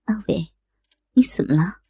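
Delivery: softly, almost whispered concern; soft tender question. A narrator's reading. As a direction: ask gently and quietly. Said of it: "柔声问道".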